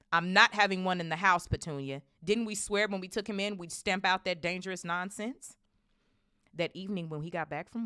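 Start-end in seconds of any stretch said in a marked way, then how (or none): 0.1-5.6 loud, raised angry insistence; heated, forceful refusal; indignant. A narrator's reading. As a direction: rant it with outrage. Vernon rails against magic.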